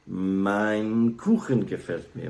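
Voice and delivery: "Mein Kuchen gefällt mir" said slowly with German ch; slow German example. Shown as a demonstration. Teaching a cohost German, slow example sentence. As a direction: give the example slowly, like a lesson. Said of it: "So you could say".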